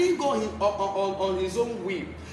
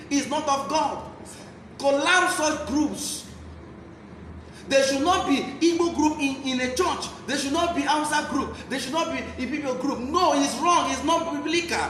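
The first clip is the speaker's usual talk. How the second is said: fervent preaching; 3 semitones higher than usual. Acted. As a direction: preach it hard to the flock. Preacher exhorts the church.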